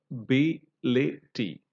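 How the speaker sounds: ability broken into slow separate syllables. Shown as a demonstration. Teacher shows how the word ability is written, syllable by syllable. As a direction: say ability piece by piece, a-bi-li-ty. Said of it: "when you write, you know".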